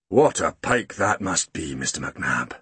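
slow, low and grave, lightly voiced; solemn. Grumble to the soaked postman, mock-solemn and low. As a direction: hold back a smile, deadly serious. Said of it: "he said solemnly".

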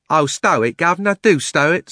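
loud, high London cabby; cockney cabby. Acted. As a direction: shut him up, cabby-style. Cabby interrupts.

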